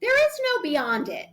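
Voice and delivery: high, insistent declaration; emphatic. Real, not acted. To the host, insisting grief has no beyond, high and firm.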